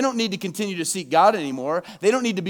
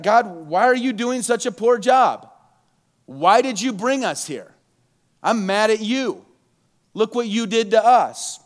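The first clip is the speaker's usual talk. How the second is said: raised, higher accusing plea to God; blaming God; 4 semitones higher, 5 dB louder than usual. Retold or imitated. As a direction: hurl the blame upward, loud and high. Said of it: "He's blaming God".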